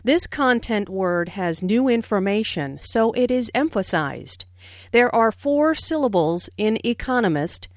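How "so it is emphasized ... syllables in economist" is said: normal talking voice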